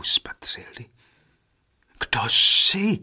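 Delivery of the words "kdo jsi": high, louder, sudden question; startled question. A narrator's reading. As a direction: demand to know who she is. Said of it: "ptali se jedním hlasem".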